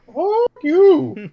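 high, drawn-out mock curse; comic high-pitched indignation; mock outrage. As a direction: sing it out high at the retreating neighbor. Acted reaction to woman snubbing trick-or-treaters.

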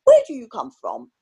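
mock posh upper-class English accent, loud peaks; imitating a pompous posh English gent. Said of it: "can't even do a posh accent".

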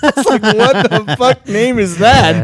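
laughing in disbelief; high, slow, laughing. Real, not acted. Laughing at odd game name.

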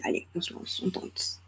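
very soft, voiceless whisper; whispered self-encouragement. Retold or imitated. Podcaster telling herself to go for it, whispered. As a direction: whisper it as if to yourself. Quoting her own resolve to herself.

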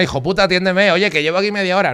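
mock angry bar customer; rude customer. Giving the rude example to contrast, mock angry demand. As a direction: snap like a rude punter. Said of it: "no es faltoso".

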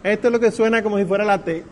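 ordinary delivery

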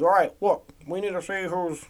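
a lightly voiced Obama, measured and deliberate; Obama impression. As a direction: ease into a mild presidential Obama cadence. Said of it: "that's my Obama impression".

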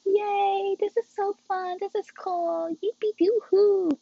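put-on over-the-top happy teenager; mock glee. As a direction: chirp it like a giddy kid. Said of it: "I'm just like".

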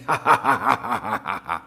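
deep gloating villain chuckle; low evil laugh. Acted. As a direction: cackle with triumph. Villain's laugh after threat.

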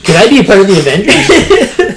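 put-on high-pitched needy kid; hammed-up plea, half laughing; mock eager begging. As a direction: beg to be let into the club. Mocking Peter pestering Tony.

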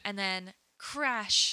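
slow drawn-out reveal; dramatic slow. Acted. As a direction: drag the crash out slowly. Dramatic plot beat.

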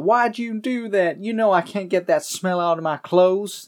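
high, whining mock complaint; mock complaining. Darla complains in retelling.

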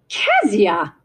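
startling call of a name; calling out sharply. A narrator's reading. Aunt catches her niece with visitors, calling out sharply. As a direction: cut in with a sudden, mildly stern call.

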